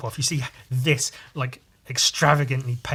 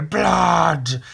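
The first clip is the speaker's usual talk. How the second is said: loud, raised war cry; mock-furious cry; 4 semitones higher, 8 dB louder than usual. Angry cultists calling for blood, loud yell. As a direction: bellow it like an enraged cult. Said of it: "they're all mad as fuck".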